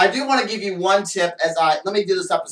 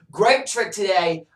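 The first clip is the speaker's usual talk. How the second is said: ordinary talk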